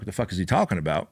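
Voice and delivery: mock confusion. Listener doubting the Noah analogy, quick mock confusion. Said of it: "if you're like".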